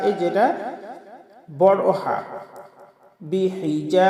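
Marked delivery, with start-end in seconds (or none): 3.2-4.1 "বিহিজা" with the final a held; short madd. Shown as a demonstration. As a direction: chant "বিহিজা", stretching the last vowel one count. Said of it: "যাতে এক আলিফ টান". ("বিহিজা"-র শেষে এক আলিফ টান)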